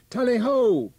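high, loud huntsman's call; hunting cry. A narrator's reading. Old huntsman hollering to the pack from the island. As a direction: sing it out across the river to the hounds. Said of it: "held while he cried again".

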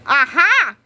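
loud burst of triumph; a gotcha shout. A narrator's reading. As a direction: bark it out like catching them red-handed. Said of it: "he shouted".

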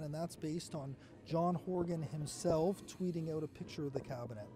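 low, soft live commentary; hushed commentary. Real, not acted. Reporter explains the crowded ceremony to viewers, hushed and low. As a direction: keep your voice well down, under the event.

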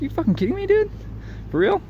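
can't believe what you just heard; incredulous. Retold or imitated. Stunned reply to a woman who gets better offers. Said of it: "It's like".

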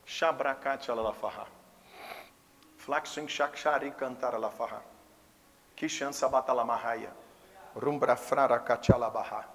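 soft, steady murmured tongues; quiet glossolalia demo; speaking in tongues. Shown as a demonstration. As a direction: show how praying in tongues sounds, low and quiet. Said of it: "É isso aqui, ó".

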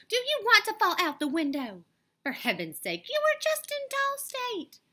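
high, loud nanny's reprimand; alarmed scolding. A narrator's reading. Nanny pulls Annabelle back from the window, scolding. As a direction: scold a child in a high, stretched voice.

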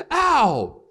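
high, loud yelped ow; mock cry of pain. Mom hit in the eye by a photo, yelping ow. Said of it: "She was like".

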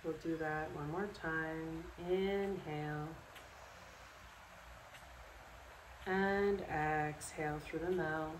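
calm, unhurried guided relaxation with long pauses; guided meditation. Acted. Yoga instructor guiding listeners to sleep in bed, calm meditation voice. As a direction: lead them through it step by step, leaving space after each instruction.

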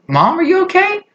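high-pitched, squeaky question; mock concern. Child on phone checking on freaking-out mom, high mock concern. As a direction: ask it in a high mock-worried voice.

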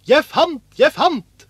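high, loud cry of discovery; excited shout. A narrator's reading. Askeladden calls to his brothers about a find, shouting. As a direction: call out thrilled at a find. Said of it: "ropte han".

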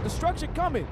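loud, high warning cry; warning shout. Retold or imitated. Preacher repeating the officer's warning, loud and high. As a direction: sound the alarm of coming doom. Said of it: "He said".

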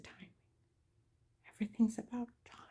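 hushed whisper, quick and breathy; whispered secret. Retold or imitated. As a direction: whisper it like a sly confession. Dave's secret retold as whisper.

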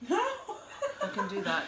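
high slow posh British put-on; British accent. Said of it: "You like my British accent".

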